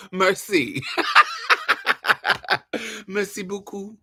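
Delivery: giggling through a mock "merci"; trying to say merci while cracking up; laughing merci. Real, not acted. Mocking French through laughter.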